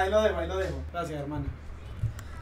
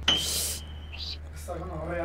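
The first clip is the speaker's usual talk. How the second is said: whisper; 5 semitones lower, 10 dB quieter, much slower than usual. Real, not acted. Whispers in shock.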